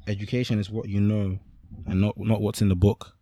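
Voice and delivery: usual speaking voice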